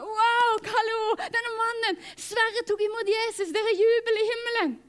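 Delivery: high, loud, overjoyed cry; excited cheer. Retold or imitated. Telling the team a man was saved, overjoyed. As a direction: burst out in celebration.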